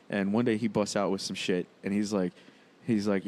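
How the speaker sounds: ordinary delivery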